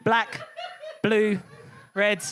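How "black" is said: normal talking voice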